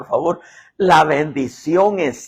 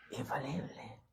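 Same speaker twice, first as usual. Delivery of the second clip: whisper; 3 semitones lower, 21 dB quieter than usual. Acted. Echoed word, hushed.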